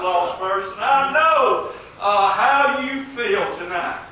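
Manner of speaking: impassioned pulpit delivery with punched key words; forceful preaching. Real, not acted. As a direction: drive each point home to the congregation.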